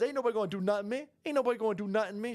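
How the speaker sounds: high, rapid-fire imitation of her; quick high-pitched mock bravado; mock defiant protester.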